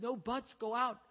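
high, loud acted order; sharp command. Sita cuts off Lakshman, ordering him.

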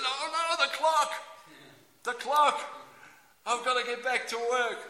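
high, loud cry of alarm; hammed-up horror at the clock; mock panic. Worker realising lunch is over, mock panic.

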